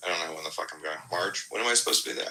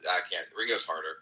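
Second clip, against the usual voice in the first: ordinary talk, nothing marked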